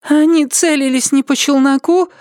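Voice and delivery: put-on high, thin young voice; thin little voice. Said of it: "тоненьким голоском вмешалась Раджприт".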